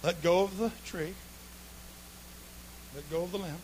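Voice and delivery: calm instruction. Acted. Jesus calmly instructs.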